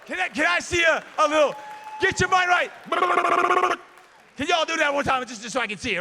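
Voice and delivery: loud, high call to the room; rally the students; calling out to crowd. Real, not acted. Speaker asking the students to chant, loud call.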